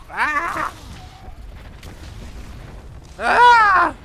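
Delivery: high, very loud screams; panicked screams. Real, not acted. Player being beaten on, high loud screams. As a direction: scream out under attack.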